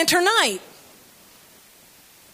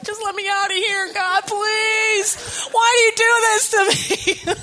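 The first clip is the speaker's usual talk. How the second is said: higher, hammed-up plea to God; mock pleading prayer; 7 semitones higher than usual. Preacher retelling her silent prayer at the register, mock pleading. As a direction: beg God for escape, half-joking. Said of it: "I was like".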